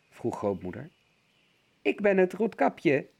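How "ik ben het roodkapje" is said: wolf die hoog en lief doet als Roodkapje; hoog stemmetje. A narrator's reading. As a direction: een listige wolf die zijn stem hoog maakt. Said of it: "jokte de wolf met een hoog stemmetje". (wolf met een gespeeld hoog meisjesstemmetje)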